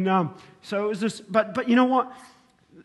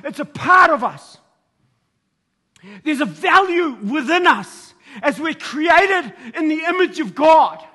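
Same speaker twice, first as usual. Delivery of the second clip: full-force preaching; 5 semitones higher, 5 dB louder than usual. Acted. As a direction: preach it hard to the congregation. Preaches about love at full force.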